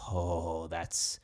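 ordinary talk

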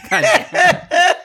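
reacting to Shannon's misused word, laughing; laughing exasperation. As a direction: squeal it out through laughter.

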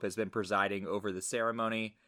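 normal talking voice

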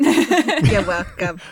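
slow, drawn-out laughing "You're welcome"; laughing smug reply. Real, not acted. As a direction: savor the cheeky finish.

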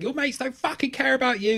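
high-pitched imitation of screaming girls; mock screaming. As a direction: plead shrilly as the girls, not at full volume. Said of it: "two girls screaming over and over".

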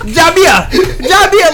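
high, loud laughing mimicry of "Ja Bear"; mocking taxi driver. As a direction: mengejek "Ja Bear" seperti sopir taksi, sambil tertawa. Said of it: "you sound like a fucking taxi cab driver".

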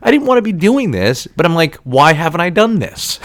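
high-pitched exasperated protest, acted out; retold anger. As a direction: re-enact arguing with oneself, worked up. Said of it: "I was angry".

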